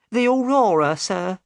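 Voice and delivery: high-pitched woman supplying a name; Missus Smith, helpful. A narrator's reading.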